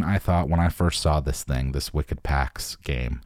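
ordinary delivery

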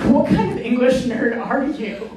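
slow, laughing mock question; teasing sisters. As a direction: tease a word nerd fondly. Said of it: "the sisters looked at me like".

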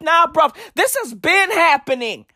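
rising, heated insistence; growing exasperated insistence; insistent. Retold or imitated. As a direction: argue it's nothing new. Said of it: "I was like".

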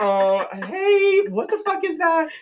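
high, squeaky disbelief; strained politeness climbing into a shocked squeal; alarmed. Retold or imitated. Director startled by the robot.

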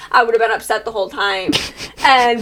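ordinary talk, nothing marked

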